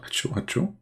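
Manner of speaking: soft breathy mock sneezes; fake sneezes. Shown as a demonstration. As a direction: whisper out two pretend sneezes. Demonstrates sneezing.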